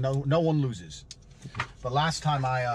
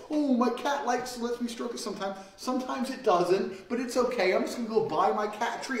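mock meek cat owner; 8 semitones higher than usual. Mocking soft cat owner.

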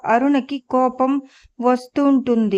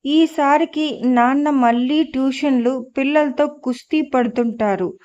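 ordinary delivery

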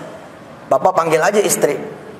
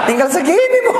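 laughing; 7 semitones higher than usual. Real, not acted. Laughing at little coffee left.

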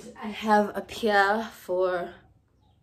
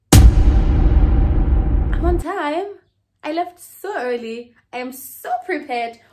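very slow, loud, high protest; indignant; 6 semitones higher, 12 dB louder, slower than usual. Retold or imitated. At the salon gate, upset at waiting, a loud stretched protest. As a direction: stretch it out in outrage. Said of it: "And I'm just like".

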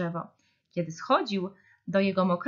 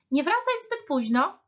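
higher-pitched motherly reminder; admonishing; 9 semitones higher than usual. A narrator's reading. Deer's mother to her child before play, admonishing. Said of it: "napominała mama sarenki".

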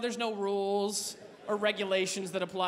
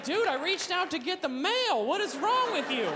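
exasperated; 6 semitones higher than usual. Retold or imitated. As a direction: scold the dog. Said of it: "You're like".

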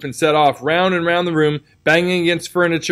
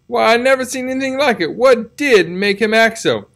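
Aunt Polly voice, higher, slowing at the end; a fussing old aunt, pitched up; puzzled Aunt Polly; 7 semitones higher than usual. A narrator's reading. Aunt Polly presses Tom about the cat, higher and puzzled.

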